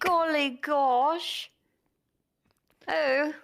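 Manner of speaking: slow, drawn-out posh British exclamation; hammed-up posh British. Caller is at the phone, so a posh British gasp. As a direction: stretch out a posh British golly gosh. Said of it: "we do this in our British accents".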